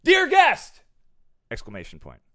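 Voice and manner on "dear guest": mock shouted greeting. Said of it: "It read".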